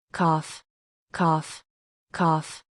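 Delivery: three harsh coughs; violent coughs. A narrator's reading. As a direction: cough hard three times. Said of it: "The doctor coughed violently".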